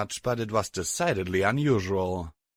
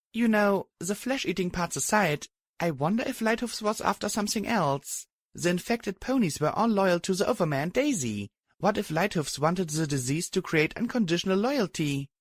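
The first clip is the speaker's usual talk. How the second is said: put-on higher young mare, musing; speculating heroine voice; 9 semitones higher than usual. A narrator's reading.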